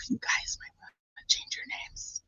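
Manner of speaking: hushed, whispered mock caution; whispered warning. Streamer retelling a tip to chat about their names, whispered warning. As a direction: drop into a soft, cautionary whisper.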